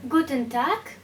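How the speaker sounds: normal talking voice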